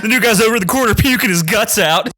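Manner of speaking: frantic yell. Retold or imitated. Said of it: "Tommy Boy".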